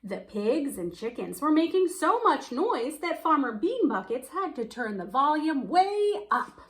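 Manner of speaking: plain reading voice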